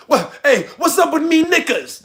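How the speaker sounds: high-pitched mock British; mock-British, pitched well up; mock British accent. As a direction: tease in a put-on British accent. Mocks British word knickers.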